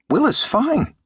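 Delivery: put-on high, squeaky boy-like creature voice; tiny high-pitched parroting voice; Martian pet mimicking a boy. A narrator's reading. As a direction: chirp it high, like a small creature copying a boy. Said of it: "His voice was remarkably like Jim's".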